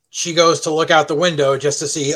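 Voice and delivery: normal talking voice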